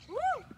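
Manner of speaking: high whoop; high cheering woo. Real, not acted. Cheer during lunge set.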